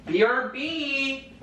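slow, high spelled-out BRB; drawn-out letters. Acted. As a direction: spell each letter slowly. Spelled out slowly.

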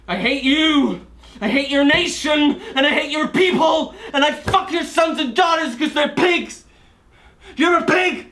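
high, forceful shouted curses, climbing to the end; furious outburst. Acted. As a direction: hurl hatred at the whole court. Curses the court in fury.

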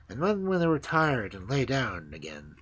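usual speaking voice

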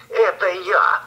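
put-on wolf faking a high thin girl's voice. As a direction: squeak it out like a sly wolf playing a girl. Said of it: "отвечает волк тоненьким голосом".

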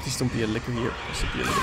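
ordinary talk

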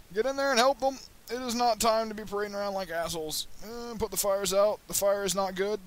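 loud, high frantic commands; yelling orders. Real, not acted. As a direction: bark orders at the crew in a panic.

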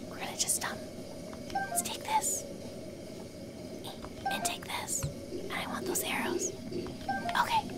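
sneaky looting whisper. Pretend. Gamer whispering while stealing weapons near enemies. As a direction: breathe each word, stealing quietly. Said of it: "I don't know why I'm whispering".

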